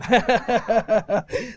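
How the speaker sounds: long chuckle; amused laugh. Real, not acted. Preacher joking with congregation about his accent, long amused chuckle. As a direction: chuckle along with the crowd.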